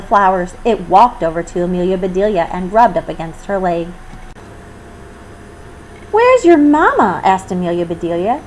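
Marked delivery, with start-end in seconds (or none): 6.1-7.2 higher, slow cooing to a kitten; tender. Acted. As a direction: coo it gently to the little cat. Speaking to a tiny kitten.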